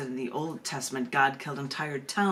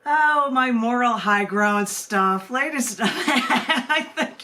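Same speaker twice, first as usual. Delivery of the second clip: laughing; 7 semitones higher, 8 dB louder, slower than usual. Real, not acted. Laughs after finishing the reading.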